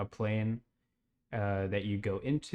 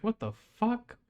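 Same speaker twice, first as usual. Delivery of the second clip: high, rising, stunned question; can't believe we're going to Florida; shocked disbelief; 9 semitones higher, 4 dB louder than usual. Retold or imitated. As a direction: squeak it out in total disbelief. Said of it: "I was like".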